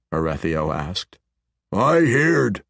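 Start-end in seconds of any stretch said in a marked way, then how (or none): 1.7-2.7 thick with anger. A narrator's reading. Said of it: "his voice thick with anger".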